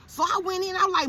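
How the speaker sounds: ordinary delivery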